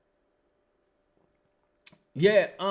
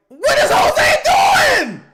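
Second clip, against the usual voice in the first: much higher and louder, slow drawn-out shout; exasperated yell; 18 dB louder, much slower than usual. Real, not acted. Fan yelling at Jose's play, slow furious shout. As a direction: scream your disbelief at the screen.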